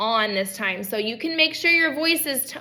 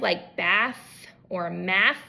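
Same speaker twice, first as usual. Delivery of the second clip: "bath" and "math" said slowly and softer, with an unvoiced th at the end; slow soft examples; 5 semitones lower, 4 dB quieter, slower than usual. Shown as a demonstration. Therapist giving ending th examples, slow and softer. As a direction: ease through "bath or math", lower and slow. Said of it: "T H in the middle or at the end".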